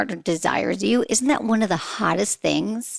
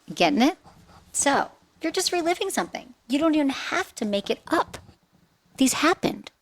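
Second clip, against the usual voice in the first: ordinary talk, nothing marked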